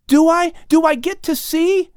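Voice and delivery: put-on high, eager child's voice, begging; a boy who can't wait any longer; young Lamech pleading with Adam, high and excited. A narrator's reading. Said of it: "Lamech keeps pleading".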